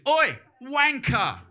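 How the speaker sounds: loud, high, drawn-out heckle; London street insult. Retold or imitated. Comic imitating Londoners insulting someone, loud yelled jeer. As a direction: yell it slowly like abuse across a street. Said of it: "in London, people, they always go like this".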